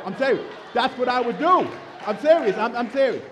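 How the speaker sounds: loud insistence. Real, not acted. Preacher insisting to the congregation he means it, shouting. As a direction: hammer it home with a comic shout.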